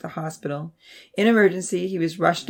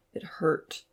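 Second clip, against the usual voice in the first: whispered; 6 dB quieter than usual. A narrator's reading. Narration dropped to whisper.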